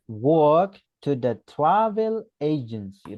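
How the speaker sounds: Walk to the travel agency, slow and deliberate; teacher modelling the answer; slow model sentence. Shown as a demonstration. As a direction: lay out each word slowly.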